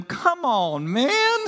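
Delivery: a mock "come on, man" that jumps high on man; playfully fed-up teacher; a hint of exasperated Jesus. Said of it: "No, he didn't, but he was thinking it".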